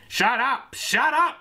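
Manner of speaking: high yelled shut up, climbing; shouting Gary. Acted. Gary shouting at the prostitute, high and sharp. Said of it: "shouted Gary".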